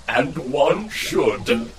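slow, savoured smug villain reply; drawn-out smugness. Acted. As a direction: stretch every word with relish.

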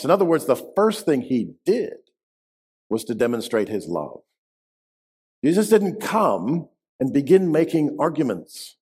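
normal talking voice

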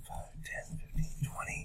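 counting under the breath; whispered counting. Real, not acted. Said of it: "counting steps quietly".

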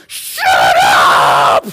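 sudden loud snapping shout at son; yelling. Retold or imitated. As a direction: bark it out in panic. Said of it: "I'm yelling at people".

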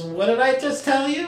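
very high, exasperated TELL YOU; high-pitched parental reproach; emphatic parent. Acted. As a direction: scold with pitch lifted right through WHAT DID I JUST TELL YOU. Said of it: "putting emphasis on it".

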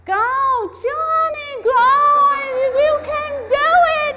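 very high, loud, drawn-out screechy cheer; whiny cheering spectator. Retold or imitated. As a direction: mimic that grating voice yelling from the bleachers. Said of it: "I hear behind me".

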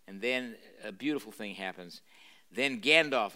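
ordinary delivery

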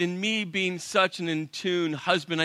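ordinary talk, nothing marked

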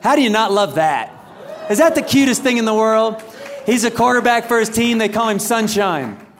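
loud, high gushing over a picture; gushing proud dad. Real, not acted. Dad showing the crowd his son's picture, gushing proudly. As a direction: crow it out with delight.

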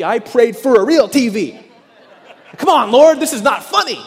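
loud, high laughing complaint to God; laughing plea. Retold or imitated. Laughing prayer complaining about broken TV.

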